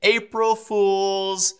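put-on high, slow, drawn-out kid taunt; little kids' voice. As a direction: sing it out like a gleeful little kid. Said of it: "These two little voices of my kids say".

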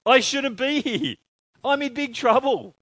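high, loud alarmed cry; the prophet realising he's doomed; loud, high mock panic; panicked Isaiah. Preacher voices panicked Isaiah.

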